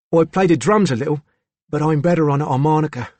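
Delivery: put-on young lad, crisp Cockney accent; crisp Cockney boy. A narrator's reading. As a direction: answer briefly as a Cockney schoolboy. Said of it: "spoke in his crisp Cockney accent".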